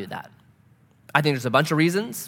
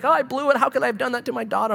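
self-loathing; 7 semitones higher, faster than usual. Retold or imitated. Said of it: "the mom had all this self-loathing".

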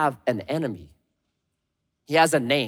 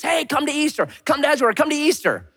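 higher, friendly eager call; upbeat invitation; 10 semitones higher, faster than usual. Retold or imitated. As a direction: invite a neighbour to Easter. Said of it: "I invite people".

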